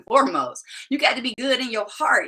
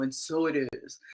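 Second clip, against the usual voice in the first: deep, softer poet's voice impression; Maya Angelou; 8 semitones lower, 6 dB quieter than usual. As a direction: lower it into a quiet, weighty pronouncement. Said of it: "or Maya Angelou".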